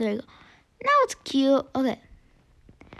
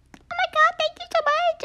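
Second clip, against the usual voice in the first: much higher, gushing thank-you; squealing thanks. Acted. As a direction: squeal your thanks like a delighted kid. Thanking in-game character.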